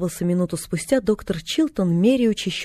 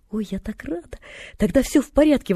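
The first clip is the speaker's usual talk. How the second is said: gasped joy, then a higher exclamation; delighted exclamation; 5 semitones higher than usual. A narrator's reading. Girl relieved by his answer, exclaiming happily. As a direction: burst out with girlish relief. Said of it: "воскликнула девочка".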